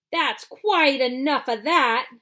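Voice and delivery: loud, slow, firm shout. A narrator's reading. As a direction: shut the crowd down. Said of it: "the fat shopkeeper shouted".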